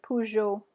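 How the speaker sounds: Peugeot as puh-zhoh, French zh; French name Peugeot. Shown as a demonstration. Teacher models Peugeot for students to repeat. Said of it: "So go ahead".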